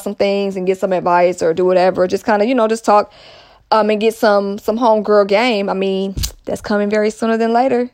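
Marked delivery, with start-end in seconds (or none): none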